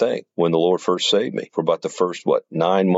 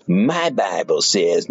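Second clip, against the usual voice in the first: slow smug childish mimicry; slow mock-pious singsong; self-righteous mocking quote; slower than usual. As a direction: sneer it out like a know-it-all. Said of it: "some people don't say it with that inflection".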